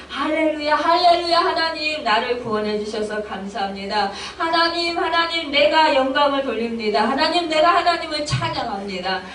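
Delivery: high, urgent calling to God; fervent praise prayer. Acted. Believer praising God in tongues' meaning, fervent prayer. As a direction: pour out thanks as fervent prayer. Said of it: "하는 뜻이에요".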